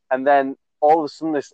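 usual speaking voice

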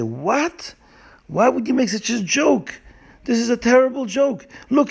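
high, outraged reproach; indignant scolding. A narrator's reading. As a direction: scold in shocked disbelief. Crowd imagined scolding the prankster.

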